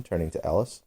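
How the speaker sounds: usual speaking voice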